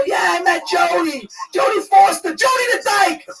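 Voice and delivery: oblivious high-pitched hollering; retold loud yell. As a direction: blurt it out for the whole room to hear. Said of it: "I yell it loud".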